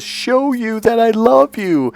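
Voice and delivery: slow, high tearful wail; acted crying falsetto; imitating crying. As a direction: draw out a girlfriend's weepy plea. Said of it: "she's crying 'cause I yelled at her".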